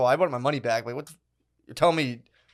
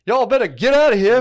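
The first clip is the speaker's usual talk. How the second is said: mock reenactor; 9 semitones higher, 8 dB louder than usual. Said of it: "would be like".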